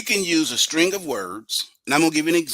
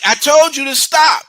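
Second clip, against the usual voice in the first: mock scolding; 12 semitones higher, 8 dB louder than usual. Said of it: "And you're like".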